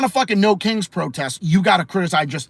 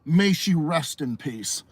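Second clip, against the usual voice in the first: usual speaking voice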